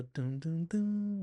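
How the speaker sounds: high, held piano notes voiced as doom; sung-out piano chords, last one held; imitated piano. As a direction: mimic an emotional piano motif, stepping upward. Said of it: "the piano hits the".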